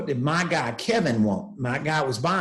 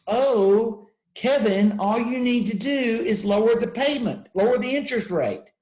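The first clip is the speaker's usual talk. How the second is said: higher, mocking know-it-all tone; 7 semitones higher than usual. Engineers lecturing Kevin on the easy fix, mocking. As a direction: toss off the obvious fix like it's easy.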